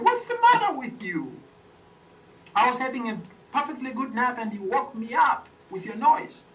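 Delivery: grumpy frog scolding the big animals; mad frog. Acted. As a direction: scold them like a tiny woken frog. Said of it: "she was mad because she'd been woken up".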